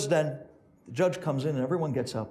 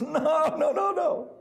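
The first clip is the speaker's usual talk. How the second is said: laughing; 11 semitones higher, slower than usual. Retold or imitated. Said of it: "starts giggling and laughing hysterically".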